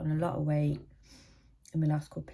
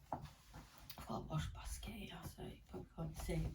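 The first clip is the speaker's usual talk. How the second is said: whispering; 17 dB quieter than usual. Real, not acted. Muttering aside about basket.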